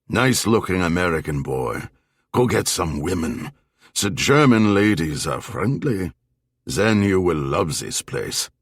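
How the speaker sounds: put-on familiar old man with a German accent; chummy German-accented advice to a foreigner; old German barfly, jovial.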